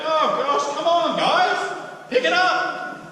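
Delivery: high, loud, slow "come on, guys"; rally them, half exasperated; exasperated urging. Acted. Coach urging the team, loud and high.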